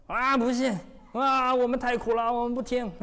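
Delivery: high, whiny mock complaint of the Israelites; complaining people. Preacher mimics the Israelites moaning to Moses, high and whiny. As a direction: pitch it up and moan like grumbling people. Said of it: "去跟我的百姓说，说".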